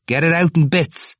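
high, loud jeer; crying out. A narrator's reading. Stout student goading Temple from the steps, crying out. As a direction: call out, egging him on. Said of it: "cried from the steps".

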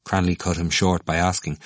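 ordinary delivery, nothing marked

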